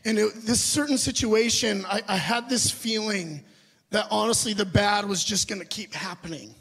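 ordinary delivery

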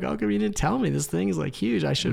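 mildly indignant, acting out the complaint; upset. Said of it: "I just felt like upset".

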